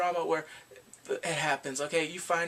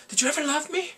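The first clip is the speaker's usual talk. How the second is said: high, loud mock pleading; hurt demand; 12 semitones higher, 5 dB louder than usual. He finally asks Eunseok, high hurt demand. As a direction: demand an answer from a lost love.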